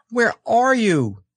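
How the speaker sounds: slow, high call; crying out. A narrator's reading. Christopher Robin searches for his friend, crying out. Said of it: "cried Christopher Robin".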